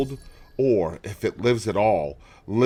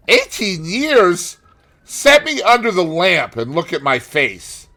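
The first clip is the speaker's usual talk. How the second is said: triumphant challenge; 10 semitones higher, 8 dB louder than usual. A narrator's reading.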